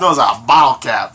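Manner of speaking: lightly put-on Boston accent; mock Boston accent. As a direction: echo the bad Boston accent. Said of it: "I can't do a Boston accent".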